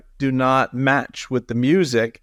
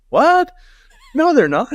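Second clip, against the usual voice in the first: much higher, louder, a startled yelp of disbelief; shocked disbelief; 5 dB louder than usual. Retold or imitated. A music fan hearing the song's real lyrics, shocked and much higher. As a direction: recoil in shock as if your favourite song was just ruined. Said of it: "And you're like".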